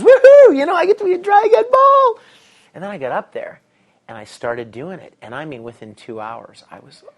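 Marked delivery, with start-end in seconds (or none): 0.0-2.2 whooping, far higher and louder; hammed-up squealing cheer; excited response. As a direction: re-enact an over-the-top celebration. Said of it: "my initial response".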